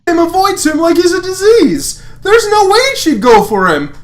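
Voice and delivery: high, loud smug dismissal; Lance voice. Acted. Lance dismissing Lotor's chances to Hunk, high and loud.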